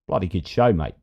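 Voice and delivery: light mock Australian accent, "Bloody good show" said at an easy pace; a casual Aussie "Bloody good show, mate". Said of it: "those accents I get in trouble for".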